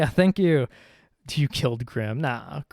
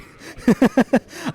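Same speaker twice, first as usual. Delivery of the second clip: loud burst of laughter; 10 semitones higher, 8 dB louder than usual. Real, not acted. Surprised by the ritual scene.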